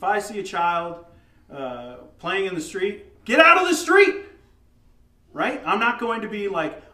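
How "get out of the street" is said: loud, high-pitched shout of warning; sudden full-voiced parental shout; urgent yell. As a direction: yell at a child to get out of danger. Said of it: "You're gonna yell".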